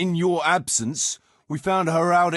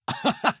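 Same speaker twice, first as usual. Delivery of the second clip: short chuckle; 9 semitones higher than usual. Acted. Laughs at where story goes.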